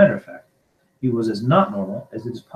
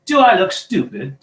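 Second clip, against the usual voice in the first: loud, high angry snarl; indignant raised voice; 9 semitones higher, 7 dB louder than usual. A narrator's reading. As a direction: snarl it in outrage. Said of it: "snarled Uncle Vernon".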